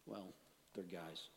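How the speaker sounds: normal talking voice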